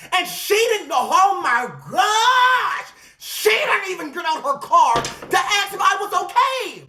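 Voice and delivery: high-pitched, indignant wail; upset outburst. Retold or imitated. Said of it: "I'm just upset".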